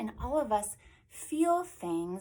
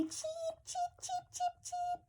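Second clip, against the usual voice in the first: tiny very high peeps; little chicks cheeping; 5 dB quieter than usual. Acted. Reader shows children the little ones, high soft cheeps. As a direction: squeak like newly hatched chicks. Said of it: "look at the little ones".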